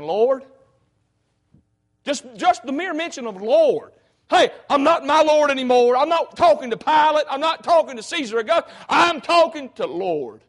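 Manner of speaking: loud, high, slowing down on the last line; preacher shouting the thief's plea at full force; fervent cry. Acted. Preacher voicing thief crying out.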